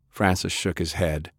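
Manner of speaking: usual speaking voice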